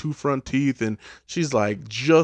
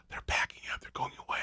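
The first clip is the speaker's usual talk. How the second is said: unvoiced hushed relief; hiding from the Girl Scouts, whispering; 11 dB quieter, much faster than usual.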